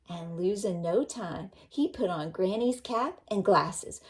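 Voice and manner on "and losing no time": ordinary delivery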